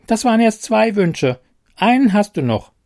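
a tiny fairy keeping count; cheeky fairy. Acted. Fairy counting his wishes.

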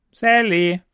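high, loud goblin answering. A narrator's reading. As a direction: state the name like a proud goblin. Goblin gives its name.

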